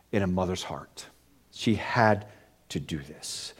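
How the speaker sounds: very soft, low awed hush; preacher falls quiet in wonder; hushed reverence. Real, not acted. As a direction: drop nearly to a whisper in awe. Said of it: "sermon hush after prayer".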